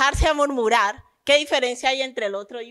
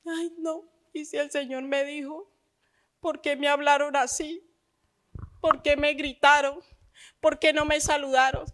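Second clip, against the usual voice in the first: mock hurt believer; 3 semitones higher, 4 dB quieter than usual. Mimics a hurt believer complaining.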